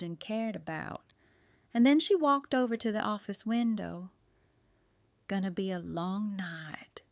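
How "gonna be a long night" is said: soft unvoiced whisper. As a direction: breathe it out at the window, hushed. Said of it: "she whispered".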